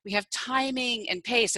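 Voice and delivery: ordinary delivery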